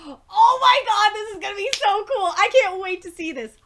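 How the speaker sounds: can't wait for it; hyped exclamation. Real, not acted. Reader hyped for the fight.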